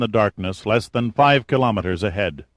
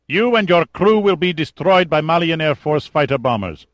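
menacing officer, higher and louder; threatening general; 8 semitones higher, 6 dB louder than usual. Acted. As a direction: warn of destruction coldly. Kazim threatens.

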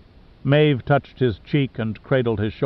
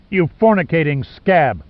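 louder, higher hateful snarl; 4 semitones higher, 4 dB louder than usual. A narrator's reading. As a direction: spit out the insult with raw hatred. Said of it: "Pitt snarled with animal hate".

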